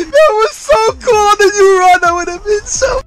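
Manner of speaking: very high, laughing through the words; overjoyed, almost crying; ecstatic laughing shout. Real, not acted.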